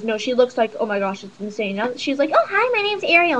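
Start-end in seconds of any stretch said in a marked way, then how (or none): 2.3-3.4 high, lilting imitation of a girl introducing herself; put-on high voice, gliding down; chirpy girl impression. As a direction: mimic her high-pitched hello. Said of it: "she talks exactly like that".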